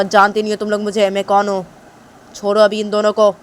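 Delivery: threatening father yelling; angry father. Acted. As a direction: roar at them to let go. Angry father confronts servants.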